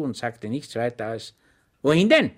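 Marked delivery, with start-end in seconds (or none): 1.8-2.3 higher, louder policeman's demand; gendarme's challenge. Acted. Gendarme confronting Schweig, high and loud. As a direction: stop him with a question.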